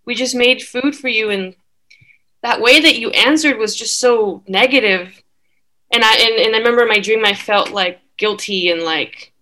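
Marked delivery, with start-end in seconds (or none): none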